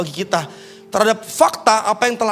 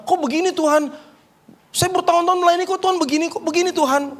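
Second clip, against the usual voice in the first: much higher, whiny complaint; whining child; 10 semitones higher than usual. Retold or imitated. Preacher mimicking his own prayer, childish whining. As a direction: whimper it like a child begging. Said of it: "kayak anak kecil yang lagi ngerengek".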